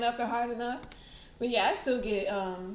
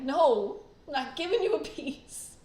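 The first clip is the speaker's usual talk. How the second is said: refusing the dog; 4 semitones higher, 5 dB louder than usual. Retold or imitated. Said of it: "I was like".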